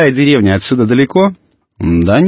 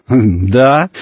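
ordinary delivery

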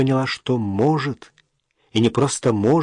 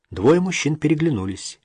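ordinary delivery, nothing marked

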